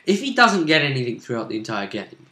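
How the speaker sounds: ordinary talk, nothing marked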